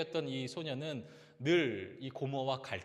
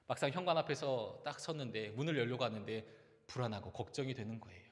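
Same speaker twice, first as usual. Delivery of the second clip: usual speaking voice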